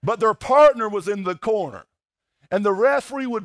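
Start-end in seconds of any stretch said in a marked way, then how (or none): none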